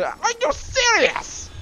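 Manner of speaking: very high, drawn-out disbelief; incredulous outburst. Real, not acted. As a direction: squeal it slowly in disbelief. Disbelief at the game.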